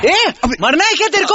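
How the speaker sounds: high-pitched alarmed rebuke; startled scolding. Acted. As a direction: snap at someone who stepped before the car. Driver scolds woman blocking car.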